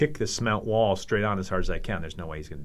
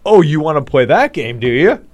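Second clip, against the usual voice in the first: louder, higher taunting retort; loud mock challenge; 6 semitones higher, 12 dB louder than usual. Rival defending his city's pride, loud acted challenge. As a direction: throw the dare back loudly.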